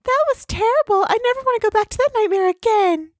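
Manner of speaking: high, dramatic fast lament; Rarity voice. Acted. Podcaster voicing Rarity after the nightmare, high and dramatic. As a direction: wail it like a fainting drama queen.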